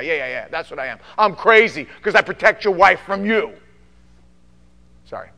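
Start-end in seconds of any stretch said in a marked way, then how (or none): 0.0-3.5 loud, high sarcastic retort; sarcastic defiant shout. Retold or imitated. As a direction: throw it back at them. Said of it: "pastor answers critics".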